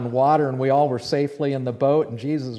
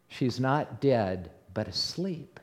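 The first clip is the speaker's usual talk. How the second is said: slow, hushed calm voice, sinking softer; quiet assurance; 4 semitones lower, 9 dB quieter, slower than usual. Acted. Jesus to a crowd of mourners, quiet assurance. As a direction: say it softly and slowly, letting the end fade.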